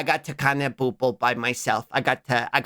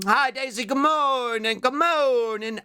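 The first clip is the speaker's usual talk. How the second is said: high sing-song hello, a little teary; cutesy greeting; 9 semitones higher, slower than usual. Real, not acted. Streamer greets a viewer in chat, high and teary.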